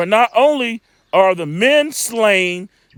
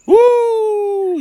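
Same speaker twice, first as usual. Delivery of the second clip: woo; 11 semitones higher, 3 dB louder than usual. Real, not acted. Preacher's excited exclamation.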